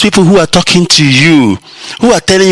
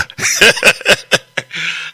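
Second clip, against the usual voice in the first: a soft, airless chuckle; quiet breathy laugh; 4 semitones higher, 5 dB quieter than usual. Real, not acted. Broadcaster laughing at how people run to Facebook, quiet laugh. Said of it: "I'm laughing, but I'm not really laughing".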